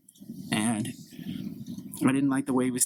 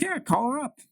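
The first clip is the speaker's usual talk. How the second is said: much higher mocking impression of Mark; mock man voice; 13 semitones higher than usual. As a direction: ham up Mark's eager reply. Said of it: "He's like".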